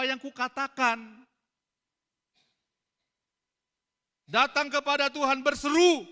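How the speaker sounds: high, loud preacher's cry; preaching at full force. Acted. Preacher voicing the Lord's rebuke at full force.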